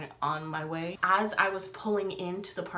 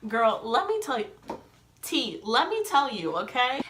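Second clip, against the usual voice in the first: sassy emphasis; 4 semitones higher, 4 dB louder than usual. Retold or imitated. Asked about her experience, sassy emphatic reply. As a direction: play up eager, sassy testifying. Sassy answer to imagined question.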